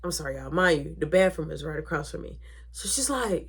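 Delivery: normal talking voice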